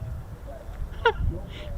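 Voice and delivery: high, breathy, unvoiced wheeze of a laugh. Real, not acted. Laughing at the circular runway joke.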